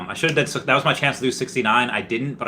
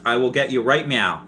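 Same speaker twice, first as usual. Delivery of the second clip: usual speaking voice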